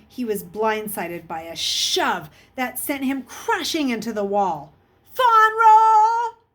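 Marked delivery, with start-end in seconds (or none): 5.1-6.4 very high, loud furious shout; explosive yell. A narrator's reading. Bully shoving the new boy, exploding in a yell. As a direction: blow up at him with full force. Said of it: "Raleigh exploded".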